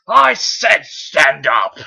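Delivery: slow, loud menacing command; menacing villain. Acted. Megatron looming over Optimus, slow loud menace. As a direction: lean on every word like a tyrant.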